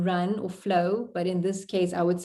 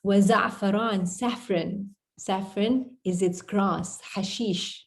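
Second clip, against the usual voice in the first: ordinary talk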